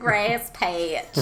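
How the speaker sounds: a hammed-up Texan twang on grass patch; mock Texan accent. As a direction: lean into a put-on Texas accent. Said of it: "I am born in Texas".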